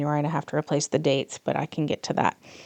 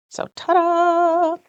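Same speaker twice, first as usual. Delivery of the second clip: much higher, slow showy "ta-da!"; ta-da reveal; 13 semitones higher, 4 dB louder, much slower than usual. Real, not acted. Crafter shows viewers her finished pages, bright drawn-out ta-da. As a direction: present it like a magic trick.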